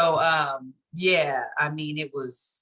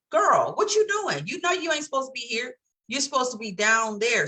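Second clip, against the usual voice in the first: scolding friend; 7 semitones higher than usual. Retold or imitated. Friend voiced scolding on cliff.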